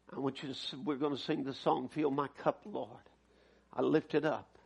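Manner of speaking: low, hushed pastoral invitation; soft and low, easing them toward prayer; gentle altar call. Real, not acted. Preacher invites the congregation to receive the word, gentle altar call.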